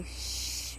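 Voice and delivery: soft, voiceless "shh"; imitated shush. Owner showing how her bird hushes the dogs, shushing. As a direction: hush them with a long hiss. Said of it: "she can say".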